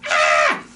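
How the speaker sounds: low loud breathy gasp of horror; mock horrified gasp. Reacting to margarine for viewers, mock gasp. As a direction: recoil as if from plastic.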